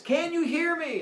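high, loud call from afar; calling across lake. Acted. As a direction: shout across the water.

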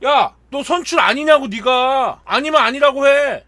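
loud, high-pitched retold confrontation; angry teammates. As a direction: act out fed-up older guys yelling at him. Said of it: "형들이 더 화가 나".

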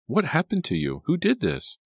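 high-pitched, alarmed questions; distressed cry. A narrator's reading. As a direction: cry out for an explanation. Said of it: "Selina cried".

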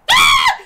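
very high, loud shriek; terrified scream. Real, not acted. Player ambushed by the creature, screaming. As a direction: screams at the jump scare. Jump scare.

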